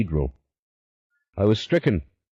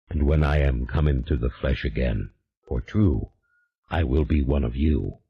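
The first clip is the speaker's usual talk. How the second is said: low, unhurried otherworldly demon, calm and sure; a patient spirit promising a way back; 6 semitones lower than usual. A narrator's reading. The demon instructs Julien in a low, calm voice.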